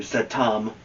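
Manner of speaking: ordinary delivery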